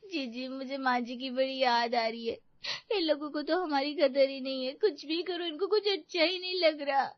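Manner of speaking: high wailing sob-talk; mock crying. Wife wails to sister-in-law about missing mother-in-law, crying. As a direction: whine tearfully, hammed-up.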